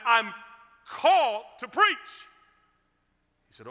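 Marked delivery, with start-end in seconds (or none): 0.1-2.3 louder, slower, each word pressed; emphatic insistence. Retold or imitated. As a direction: insist firmly, leaning on called. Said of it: "I said".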